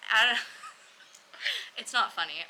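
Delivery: laughing through the words; real laughing. Real, not acted. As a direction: try to talk through a giggle. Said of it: "I'm just laughing because".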